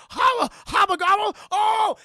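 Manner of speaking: high, shouted cartoon babble; flabbergasted gibbering. Agent spotting the name on the gun, flabbergasted. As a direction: splutter nonsense at full volume.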